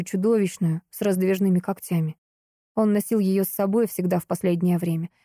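ordinary delivery, nothing marked

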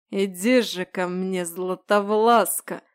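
put-on slow, slurred drunken call; drunken beckoning. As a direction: drawl it out drunk and lustful. Drunk man beckons heroine.

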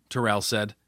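usual speaking voice

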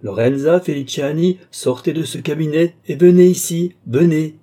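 loud, high, imperious summons; commanding cry. A narrator's reading. Count ordering Lorenza out of the cabinet, commanding cry. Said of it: "cria le comte, dominant tout".

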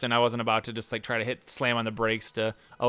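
ordinary delivery

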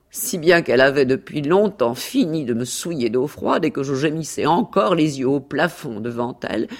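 mock-tragic storytelling with swelling phrases; theatrical, lilting account of an ordeal; self-dramatising cat. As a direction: recite your suffering with relish.

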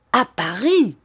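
a sudden astonished outburst; startled exclamation. Acted. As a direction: cry it out, caught off guard. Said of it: "s'écria Sir Hassel".

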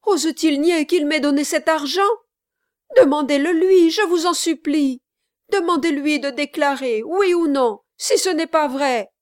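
loud, high, desperate pleading; heart-rending appeal. A narrator's reading. Kit implores the gentlemen to question Brass, heart-rending appeal. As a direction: beg them at full voice to make him answer. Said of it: "dit Kit d'une voix déchirante".

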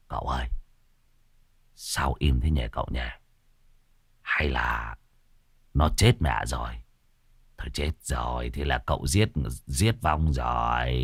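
low, soft fretting; timid worry. Acted. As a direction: murmur anxiously. Phọt timidly worried. (thì thào lo lắng)

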